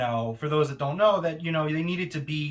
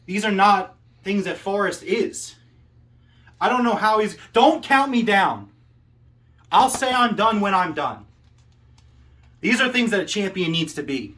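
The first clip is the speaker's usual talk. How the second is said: loud call-out; 2 semitones higher, 5 dB louder than usual. Acted. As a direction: build to a shouted threat at a rival. Promo climax calling out Forrest.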